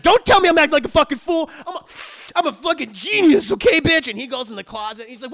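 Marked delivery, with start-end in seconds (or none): 0.0-4.1 loud, high, fast furious yell; raging shout. Retold or imitated. Harley screaming at his girlfriend, raging. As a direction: blow up at her. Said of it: "And he's like".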